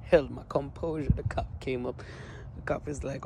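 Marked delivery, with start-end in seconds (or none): none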